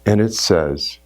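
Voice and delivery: ordinary talk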